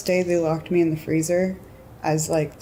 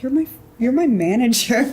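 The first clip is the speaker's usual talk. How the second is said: higher and louder, stammering in retold disbelief; incredulous; 8 semitones higher, 4 dB louder than usual. Telling her manager off, retold incredulous higher cry. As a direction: sputter it, unable to believe him.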